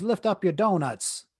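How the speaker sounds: high, loud, slow imitation in a Caribbean accent; Caribbean lady's order. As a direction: imitate a Jamaican carer giving an order. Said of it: "lady with Jamaican slash Caribbean accent".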